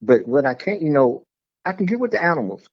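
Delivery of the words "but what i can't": ordinary talk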